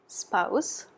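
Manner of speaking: usual speaking voice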